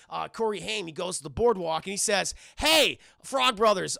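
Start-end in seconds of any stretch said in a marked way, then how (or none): 2.6-4.0 mock calling out. Voicing boy hailing the brothers.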